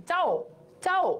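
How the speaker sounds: "চাও" stopped on a half-sounded ও; hasanta ending. Shown as a demonstration. Teacher showing the hasanta, "চাও" closed off short. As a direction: cut "চাও" short on the glide. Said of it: "এবার হস পড়ে যাচ্ছে".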